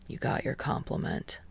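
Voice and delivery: soft, near-whispered mumble; mumbling. A narrator's reading. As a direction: say it low, embarrassed. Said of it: "he mumbles".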